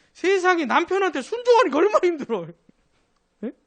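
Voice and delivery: high, exasperated cry of how hard it is; high pitched rhetorical exasperation; exasperated exclaim. Real, not acted. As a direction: throw up your hands at the difficulty.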